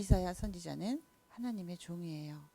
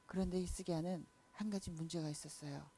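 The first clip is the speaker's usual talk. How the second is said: ordinary delivery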